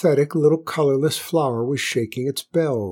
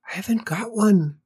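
small, high, timid flower voice; high-pitched bashful little flower; shy whispering flower; 9 semitones higher than usual. A narrator's reading. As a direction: answer shyly in a tiny high stage whisper. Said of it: "whispered the flower".

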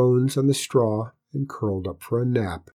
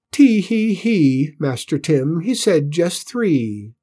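high, drawn-out jeering imitation; mocking squeaky mimicry; 5 semitones higher, 4 dB louder, slower than usual. A narrator's reading. Stable boys mock Cinders's excuse, squeaky mimicry. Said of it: "They mimicked Cinder's small, squeaky voice".

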